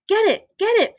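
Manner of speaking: high mock old-woman cry; lightly voiced old woman, urgent; old woman's shout. A narrator's reading. Frankie shouts after the mouse, old woman's voice. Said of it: "shouts in his old woman's voice".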